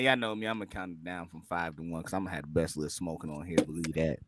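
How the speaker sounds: low, soft radio host voice; deep smooth radio DJ. Acted. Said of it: "why did he put on the radio voice".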